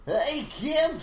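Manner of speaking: raspy cartoon clown shout; Krusty the Clown voice. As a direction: bark it like a TV clown. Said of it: "That's pretty close to a Krusty".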